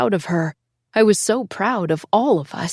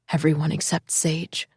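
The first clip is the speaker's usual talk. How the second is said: plain reading voice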